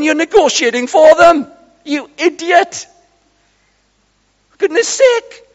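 high-pitched, incredulous telling-off; acted exasperation, pitched high; exasperated self-scolding. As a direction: scold yourself in mock disbelief. Scolds himself in acted inner voice.

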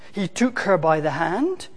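usual speaking voice

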